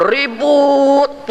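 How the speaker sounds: "Ribut" drawn out on a high, held pitch; "Ribut" as a long, high retold wail; emphatic complaint. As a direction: stretch "Ribut" high for dramatic exasperation. Retold husband's confession of constant quarrels.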